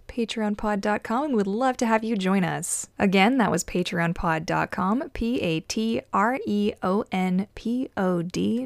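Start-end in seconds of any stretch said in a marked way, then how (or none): 5.1-8.7 spelled out slowly. Shown as a demonstration. Spelling out the website name.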